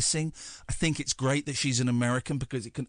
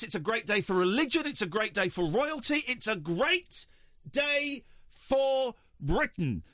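high, overexcited royal superfan; a hammed-up breathless royalist; gushing royalist; 9 semitones higher, slower than usual. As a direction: gush like a devoted fan of the Crown. Royalist persona hails the birth.

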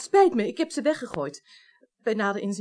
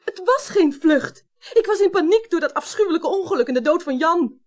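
agitated protest; 5 semitones higher, 5 dB louder than usual. Acted. Defends herself under questioning.

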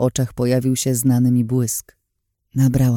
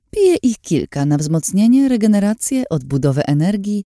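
mock-bright sarcasm, higher pitched; sarcastic; 7 semitones higher, 3 dB louder than usual. A narrator's reading. Lin lists her elixirs to the narrator, dripping sarcasm. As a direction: list them off with lively sarcasm. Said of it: "jej głos kipiał od sarkazmu".

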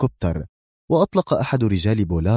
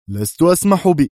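sharp; 8 semitones higher, 5 dB louder than usual. A narrator's reading. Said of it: "في حدة".

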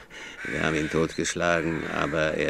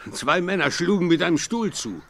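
excited retelling; 11 semitones higher, 7 dB louder than usual. Acted.

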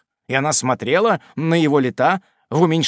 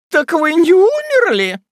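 much higher, louder shrill squeal; hysterical shriek; 7 dB louder than usual. A narrator's reading. Hysterical aunt shrieks at the wounded Tupman. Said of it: "взвизгнула истерическая леди".

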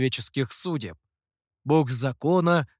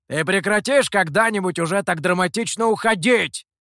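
loud, high call after someone leaving; shouted after; 4 semitones higher, 5 dB louder than usual. A narrator's reading. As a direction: yell it at a friend's back. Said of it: "крикнул Михоу вслед Гуэю".

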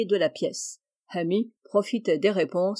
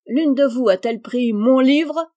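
sharp, loud, higher demand; brusque demand; 4 semitones higher, 7 dB louder than usual. A narrator's reading. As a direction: bursts in demanding the book. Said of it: "demanda brusquement". (demande brusque et forte)

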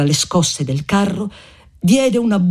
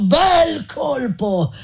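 jeering shout; 9 semitones higher, much slower than usual. Acted. Monatti jeering at the crowd.

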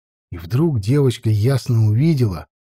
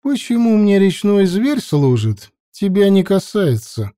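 unbothered; 6 semitones higher than usual. A narrator's reading. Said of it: "не смущаясь".

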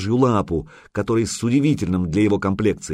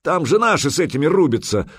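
loud, higher viking roar; 7 semitones higher, 3 dB louder than usual. Acted. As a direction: bellow like an alarmed viking. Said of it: "взревел медвежья лапа".

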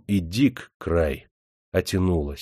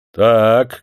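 loud gruff grunt of a word; 7 dB louder than usual. A narrator's reading. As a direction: grunt it like a lordly master. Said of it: "крякнул Никита".

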